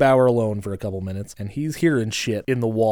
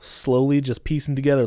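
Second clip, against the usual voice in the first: ordinary talk, nothing marked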